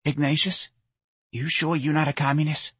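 high, coaxing mother's question; sly, suspicious mother voice; sly asking. Acted. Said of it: "looked at her son slyly and asked".

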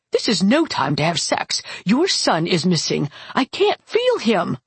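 imperious aunt, pitch climbing into alarm; agitated aunt. Acted. Aunt scolding the couple about the missing son, agitated. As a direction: scold urgently, rising high at the end.

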